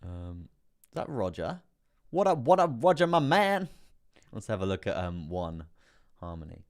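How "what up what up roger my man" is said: loud, high-pitched hype shout-out; hyped greeting. Real, not acted. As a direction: greet a buddy with a big, raised voice. Loud hello to a viewer.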